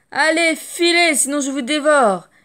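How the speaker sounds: scare them off with a roar; loud playful threatening yell; menacing shout. Acted. Mouse scaring the elephants.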